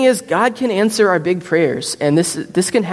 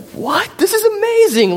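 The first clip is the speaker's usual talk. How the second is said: sudden high squeal of surprise, much higher; amazed disbelief. Retold or imitated. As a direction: gasp it out, stunned and thrilled. Patient hears of new heart.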